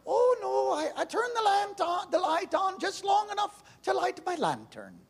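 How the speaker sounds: put-on high, lilting Irish old lady; Irish lady explaining she uses plenty of power; Irish dialect. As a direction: play the Irish cottage lady, high and sweet. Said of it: "with an Irish dialect".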